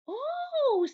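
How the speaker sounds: high delighted gasp of an oh; surprised oh. A narrator's reading. Mother greeting the surprise cookie, high surprised oh.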